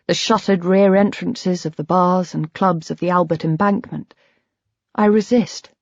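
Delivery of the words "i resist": ordinary delivery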